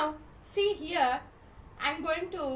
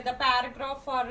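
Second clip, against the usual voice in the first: usual speaking voice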